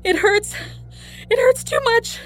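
very high, loud agonized wail; anguished high-pitched cry; crying out in pain. A narrator's reading. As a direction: cry out as labor pain overwhelms you. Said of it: "she cried".

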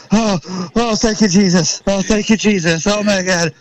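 reliving the rush of the kill, gushing; freaking out. Retold or imitated. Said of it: "And I'm freaking out".

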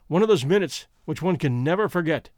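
plain reading voice

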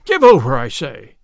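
exasperated narrated outburst; fuming. A narrator's reading. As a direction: snap it in a fume. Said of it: "quoth Robin in a fume".

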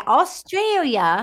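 teasing, put-on Australian accent; mock Australian accent. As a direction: mimic a bad Australian accent, stretching AUSTRALIA. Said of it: "So you were like".

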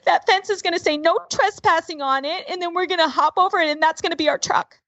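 put-on high, quick child's voice; imitated little boy. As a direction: rattle out a hopeful plan like a kid. Child voiced explaining hopeful plan.

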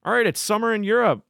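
high, eager "let's go"; excited. Retold or imitated. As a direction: pump it up like a hyped DJ. Mimicking DJ touring energy.

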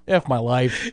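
low, soft despairing groan; mock despair. Assistant watching the argument, mock despair. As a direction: give up on everything, groaning.

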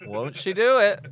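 excited catchphrase. Acted. Catchphrase shouted in banter.

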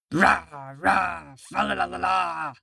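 tiger roar. A narrator's reading. Freed tiger leaps at the boy, playful roar. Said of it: "roared the tiger".

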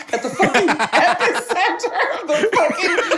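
high, laughing exclamation; laughing punchline. Real, not acted. Joking to the host about the quake-zone museum, laughing high. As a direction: crack up while delivering the punchline.

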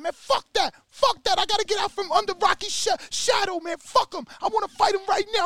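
hammed-up hothead itching to fight; Tommy raging. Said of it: "He like".

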